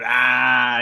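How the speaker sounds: loud drawn-out "aah" yell; yelled battle cry. Acted. Kobold running past Wynn breathing fire, loud yell. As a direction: yell it out as you charge. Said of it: "I just yell out".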